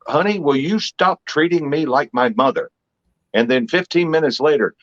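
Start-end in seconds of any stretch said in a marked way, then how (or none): 0.1-2.7 mock complaint, pitched up; lightly acted protest to a girlfriend; mock arguing boyfriend. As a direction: complain in a higher voice, playfully. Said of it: "have arguments with their girls".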